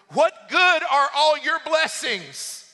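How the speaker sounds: high, loud, drawn-out protest; acted complaint. Acts Abraham's complaint to God.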